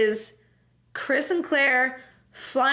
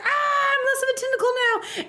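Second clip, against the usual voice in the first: high-pitched comic outrage; mock pissed off; 11 semitones higher than usual. Tentacle reacting to being cut, mock outraged squeal. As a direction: squeal in hammed-up anger. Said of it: "the tentacle's all pissed off".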